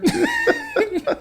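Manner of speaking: real uncontrolled laughter; burst of laughter. Real, not acted. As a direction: crack up at the punchline. Laughing at the punchline.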